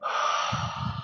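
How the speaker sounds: long soft unvoiced out-breath; deep cleansing exhale. Real, not acted. Leading a group breath on the call, soft exhale. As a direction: breathe it all out slowly.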